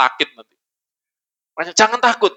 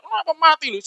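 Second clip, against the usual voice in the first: mock panic; 10 semitones higher than usual. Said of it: "Mereka teriak-teriak".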